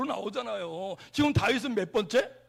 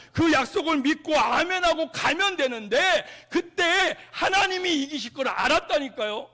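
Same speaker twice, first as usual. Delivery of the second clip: louder, slowed climax of "이겼노라"; full-force preaching; 2 semitones higher, 7 dB louder than usual. Acted. Preacher proclaiming God's victory in full-force preaching.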